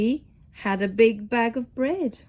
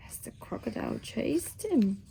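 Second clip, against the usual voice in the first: tense hushed whisper; 8 dB quieter than usual. Acted. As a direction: whisper it, building suspense.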